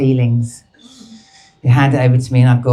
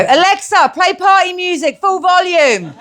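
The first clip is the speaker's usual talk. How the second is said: mock command to Alexa. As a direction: call out to the speaker like a party host.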